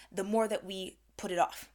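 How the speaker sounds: ordinary delivery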